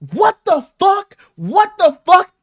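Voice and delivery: high, drawn-out disbelief; incredulous outburst. Retold or imitated. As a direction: replay stunned exclamations, each word stretched. Replaying repeated outbursts in room.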